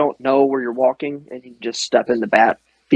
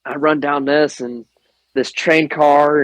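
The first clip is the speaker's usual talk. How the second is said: normal talking voice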